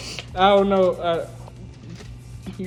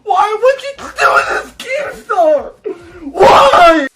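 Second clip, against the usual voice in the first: high, loud fake crying wail; mock wail; 12 semitones higher, 13 dB louder, slower than usual. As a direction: wail it like a hammed-up sob. Exaggerated wail at Keemstar.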